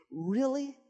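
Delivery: high, amazed Really with a laugh between; pleasantly stunned, asking it twice; delighted surprise. Retold or imitated. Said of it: "I thought to myself".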